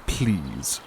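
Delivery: ordinary talk, nothing marked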